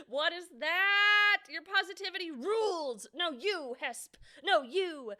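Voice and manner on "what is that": high, loud, slow and drawn out; surprised. Real, not acted. Streamer reacting to something odd on screen, loud and slow. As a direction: stretch it out in disbelief.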